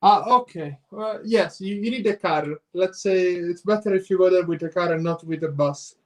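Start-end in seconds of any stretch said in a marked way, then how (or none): none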